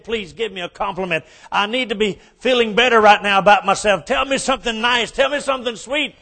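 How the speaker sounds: high, loud whine about her looks; hammed-up wife fishing for sympathy; whiny wife. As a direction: wail to the mirror in self-pity. Wife begging husband.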